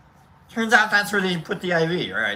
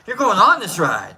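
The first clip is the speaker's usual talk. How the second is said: louder, higher retold command; insistent friend; 4 semitones higher, 7 dB louder than usual. Friend Al orders him onto ride, loudly insisting. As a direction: order him on, loud and firm.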